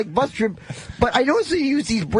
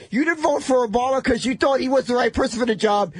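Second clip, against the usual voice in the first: ordinary delivery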